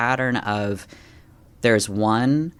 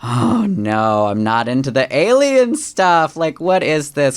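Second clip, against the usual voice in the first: louder, higher mock objection; hesitant protest; 6 semitones higher, 6 dB louder, slower than usual. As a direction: push back like it's absurd. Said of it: "I had this hesitation of".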